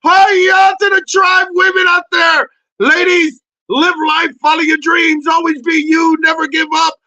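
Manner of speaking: loud, high hype greeting; loud, high cheering shout-out to the ladies; excited shout-out. Real, not acted. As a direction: pump up the crowd with loud cheer. Shout-out to the tribe women.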